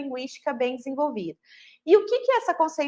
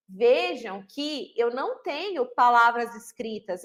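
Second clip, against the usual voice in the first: ordinary talk, nothing marked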